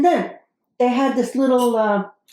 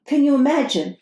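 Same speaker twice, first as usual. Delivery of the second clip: ordinary talk, nothing marked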